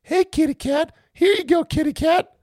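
very high sing-song kitty call; cooing to a kitty. Retold or imitated. Host imitating fans calling to the cat, squeaky high coo.

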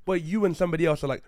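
ordinary talk

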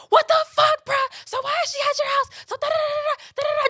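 put-on screaming at boyfriend.

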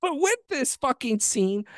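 ordinary delivery

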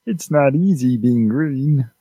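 slow, high froggy puppet voice; Kermit the Frog voice. Acted. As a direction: drawl it out like Kermit. Kermit line after Kermit mention.

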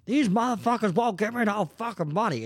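high, loud mock rant; imitated yelling. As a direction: holler like an angry panhandler, hammed up. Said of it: "just yelling at people".

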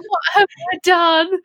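high panicked cry of dismay; distraught child. Retold or imitated. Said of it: "I'm like".

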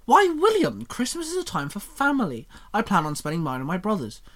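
slightly offended. Acted. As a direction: sound a little hurt and indignant. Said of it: "with a slightly offended tone".

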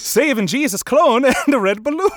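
silly Irish jingle, breaking into laughter; hammed-up Irish leprechaun. Podcaster riffs on cereal jingle, high mock-Irish leprechaun.

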